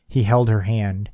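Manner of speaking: usual speaking voice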